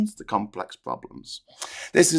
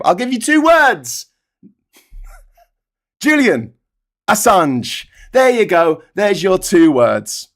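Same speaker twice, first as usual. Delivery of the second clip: gleeful punchline; 5 semitones higher, 3 dB louder than usual. Real, not acted. Punchline, laughing, raised voice.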